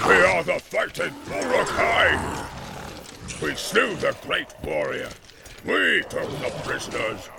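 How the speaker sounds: loud, slow boastful roar; roaring orc warlord. Acted. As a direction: boast like a war chief. Ugluk boasting, shouting.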